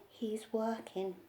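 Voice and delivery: soft, slow, final word; mother closing the matter quietly, slowly; quiet firm finish. A narrator's reading. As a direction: drop to a hushed, settled close. Mummy ends firmly.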